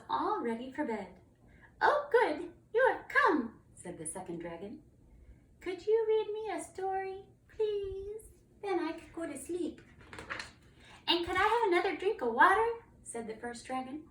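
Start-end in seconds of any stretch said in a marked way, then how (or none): 1.8-3.5 high, loud, eager dragon voice; delighted young dragon. Acted. Second dragon greets the knight. 5.6-9.8 high little dragon voice; pleading young dragon. Acted. Second dragon begs for a story. 11.1-12.7 high, loud, quick request; eager young dragon. Acted. First dragon asks for more water.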